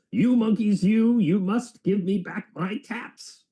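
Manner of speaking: stomp and shout it out; furious peddler shouting up at the monkeys. Acted. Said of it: "stomped both of his feet and shouted".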